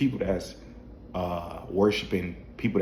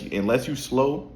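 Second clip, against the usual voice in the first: ordinary talk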